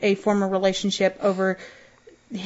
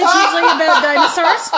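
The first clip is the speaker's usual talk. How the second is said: laughing; 7 semitones higher, 5 dB louder than usual. Real, not acted. Laughing through the correction.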